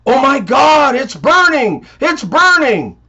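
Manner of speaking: panicked yell of burning; screaming pilot. Acted. Pilot screaming over the mic about burning. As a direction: shout it like you're on fire. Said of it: "he starts screaming while still on the mic".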